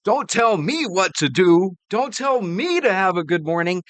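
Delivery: mock indignation. Host mocks the New Yorker's gripe about good morning, indignant. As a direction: echo the complaint with exaggerated indignation. Host mockingly echoes clip's complaint.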